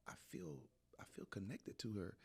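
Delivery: soft, hushed aside; quiet voice of dawning feeling; hushed realization. Retold or imitated. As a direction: say it softly, moved, as if to yourself. Recounting a moving inner realization.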